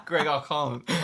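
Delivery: giving up through real laughter; laughing. Real, not acted. Co-host abandoning the accent to Greg, laughing. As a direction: collapse into laughter mid-sentence.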